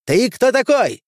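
high, loud, squealing demand; shrill. A narrator's reading. Quartermaster challenges the stranger who took his pistol, shrilly. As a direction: squeal it indignantly, pitch shot right up. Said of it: "спросил визгливо интендант".

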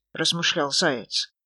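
ordinary delivery

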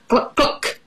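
short, voiceless clucks; little storybook hen noises; chicken cluck. Acted. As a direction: imitate a hen for children. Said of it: "said chicken".

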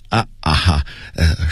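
nervous forced chuckle, stammering; awkward dry laugh. A narrator's reading. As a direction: laugh it off uneasily, tripping on words. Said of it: "干笑一声". (尴尬干笑，结结巴巴)

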